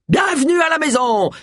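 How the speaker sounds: high-pitched shouted welcome; high, hearty cry; calling out. A narrator's reading. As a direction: call it out high to the car. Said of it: "cria-t-il".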